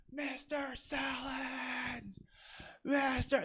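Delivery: put-on high, drawn-out kid's call from across the field; a ten-year-old hollering across the field for his teacher; kid calling from afar. Student calling teacher from far across the field, high and drawn out.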